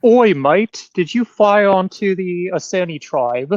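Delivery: put-on hammy outback accent, drawn out; laconic outback drawl. Mocking British officer at door.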